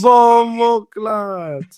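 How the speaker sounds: slow, high Jamaican patois exclamation; Jamaican accent. Pretend. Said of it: "say something in a Jamaican accent".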